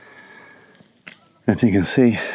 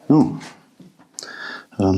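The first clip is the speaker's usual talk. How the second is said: ordinary delivery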